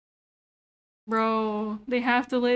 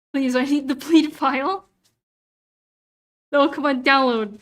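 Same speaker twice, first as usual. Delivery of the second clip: frustrated pleading; 6 dB louder than usual. Real, not acted. Begging a stalled download to work.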